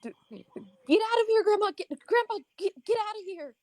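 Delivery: high, loud panicked yelling; frantic shout. Acted. Player's character yelling at grandparents to flee, frantic shout. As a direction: scream at the grandparents to run. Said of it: "it's like".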